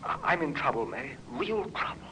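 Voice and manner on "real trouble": a frightened man whispering his confession; whispered plea. Acted. Man whispers to his old love that he's in trouble, hushed plea. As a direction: drop to a whisper on real trouble. Pleading with old flame for help.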